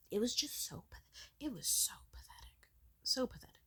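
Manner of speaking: a breathy, disgusted aside; bitter whisper. Real, not acted. Bitter whisper about her mother.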